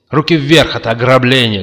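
high, loud hold-up command; loud shout. A narrator's reading. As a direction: shout to announce a robbery. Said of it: "громко крикнул он". (громкий крик)

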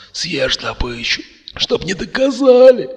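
high, choked dying rasp; gurgling. A narrator's reading. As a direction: force it out as if dying. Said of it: "булькнул Макс". (булькающий предсмертный хрип)

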